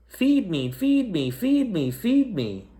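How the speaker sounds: high, tiny chick voices begging; baby birds peeping. Acted. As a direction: squeak it like hungry chicks.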